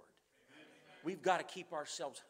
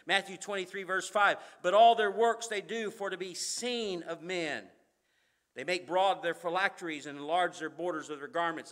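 preaching scripture; 10 dB louder than usual. Acted. Preacher reading Matthew to the congregation, loud and proclaiming. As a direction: proclaim every word to the room.

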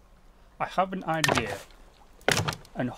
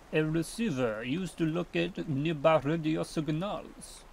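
put-on French accent; playful, unexplained French accent; 3 dB quieter than usual. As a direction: read it out in a mock French accent. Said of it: "why I put on a French accent".

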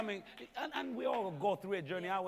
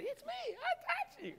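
much higher squeaky voice, laughing; mock woman's reply; 3 dB quieter than usual. Preacher voices the healed woman answering Jesus, high laughing squeak. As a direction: squeak it like an eager woman owning up. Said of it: "she'd say".

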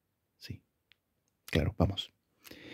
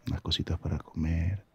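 ordinary talk

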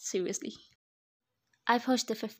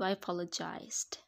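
ordinary talk

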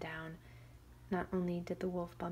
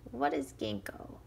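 curious wolf; 5 semitones lower, 4 dB louder than usual. Acted. Wolf asking Shang about the nuts, low. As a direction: lower growly wolf question. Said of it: "the wolf asked".

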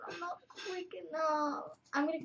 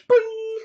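cartoon boing; 8 semitones higher, 13 dB louder than usual. Playful sound effect.

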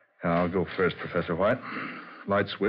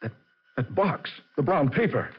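rising alarm, naming each thing; breathless realization; startled recognition; 9 semitones higher than usual. Acted. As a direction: pitch jumps up as each item clicks. Recognizing the box from the casket.

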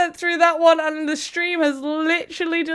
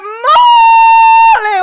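high, loud, stretched whoop; amazed shout; 11 semitones higher, 11 dB louder than usual. Real, not acted. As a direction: blurt it out long and high. Shocked at big donation.

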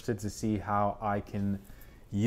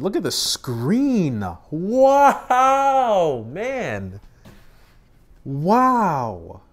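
high, loud stretched-out wow; awestruck; 8 semitones higher, 8 dB louder, much slower than usual. Real, not acted. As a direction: marvel at the screen. Screen reveal awe.

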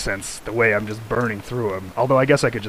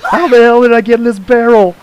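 loud, higher indignant yell for the stuffed character; hammed-up shocked outburst, much louder; mock outraged voice; 10 semitones higher, 11 dB louder than usual. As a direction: voice the baffled guy in the barrel, loud and high. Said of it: "He's like".

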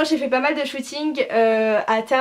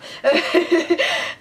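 a short, higher laugh of affection; fond laugh; 7 semitones higher than usual. Real, not acted. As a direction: laugh warmly at the memory of friends. Fond laugh after naming friends.

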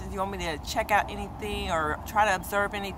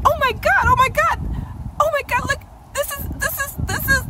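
high, loud, tripping over the words; breathless with joy, can't finish the sentence; stuttering excitement; 8 dB louder than usual. Retold or imitated. Reliving her skin reaction, high stammering squeal. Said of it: "I'm like".

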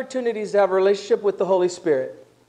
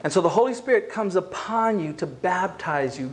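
ordinary talk

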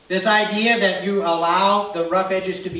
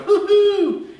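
high, loud mocking hoo-hoo; mock hoot of glee; gloating hoot; 8 dB louder than usual. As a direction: crow in triumph. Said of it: "You're going".

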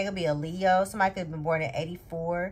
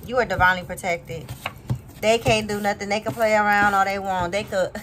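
ordinary talk, nothing marked

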